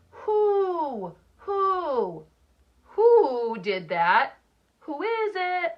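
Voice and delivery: "Who?" hooted high like an owl. Pretend. Teacher voicing Echo the Owl for the class as a high hoot. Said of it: "this is what Echo the Owl says".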